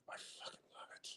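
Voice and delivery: whispered, fast gleeful aside. Real, not acted. Streamer gloating about annoying drivers, fast whisper. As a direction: hiss it out in delight.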